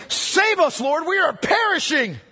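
shouted, high plea for rescue; panicked cry. Acted. Disciples waking Jesus in the storm, shouting for help. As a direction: cry out like men about to drown. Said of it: "they woke him saying".

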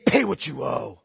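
low growled demand for payment; menacing demand. Acted. Creditor choking his debtor, low and threatening. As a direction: choke out the demand, low and hard.

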